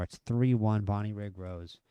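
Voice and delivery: normal talking voice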